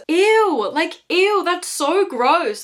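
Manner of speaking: high-pitched grossed-out ew; disgusted, high. Real, not acted. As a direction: squeal ew like it's gross.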